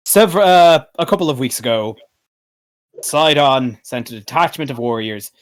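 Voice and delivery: stately in-character account; formal, measured royal report; troubled city ruler. Acted. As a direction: lay out the grim demand like a worried king. Game master speaks as Pythor.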